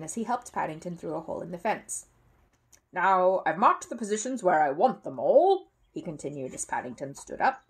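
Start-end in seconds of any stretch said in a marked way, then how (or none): 2.9-5.7 slow, louder gruff neighbour; a bossy neighbour explaining; neighbour's voice. A narrator's reading. Neighbour explains the stakes to the bear, gruff.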